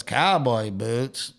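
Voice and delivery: slow, drawn-out country greeting. Retold or imitated. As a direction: stretch each word out slowly. [name]'s remark acted, drawn out.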